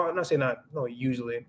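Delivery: ordinary talk, nothing marked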